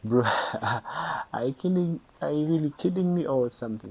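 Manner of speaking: high, loud incredulous laugh-cry of disbelief; stunned, laughing at a stranger's odd question; shocked disbelief. Retold or imitated. Retelling to viewers a friend's question, shocked and incredulous. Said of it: "Then I was shocked".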